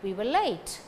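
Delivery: "late" stressed, "We were" kept weak. Shown as a demonstration. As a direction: lean only on "late". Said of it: "Late carry stress".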